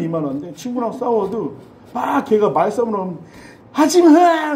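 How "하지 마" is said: put-on high tearful yell of a boy; a boy crying out as he fights; crying shout. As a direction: shout it through childish tears. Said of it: "때리면서도 울었어요".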